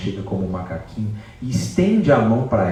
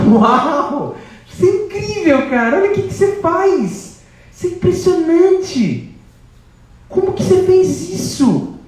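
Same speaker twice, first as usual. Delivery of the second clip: much higher and louder, slowing on the last lines; amazed; 6 dB louder, slower than usual. Acted. Sita marvelling at Hanuman grown enormous, high and loud. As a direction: gasp in wonder at a giant.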